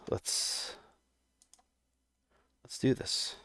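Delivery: whispered. Real, not acted. Psyching up before playing.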